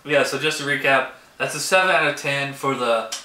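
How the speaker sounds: ordinary delivery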